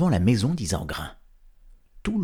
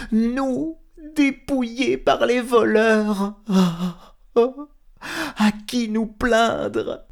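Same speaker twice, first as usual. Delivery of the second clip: high startled wail with a cry; jolted from sleep, crying in alarm; rudely awakened; 13 semitones higher, 4 dB louder, slower than usual. Acted. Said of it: "réveillé en sursaut". (réveillée en sursaut, cri aigu et affolé)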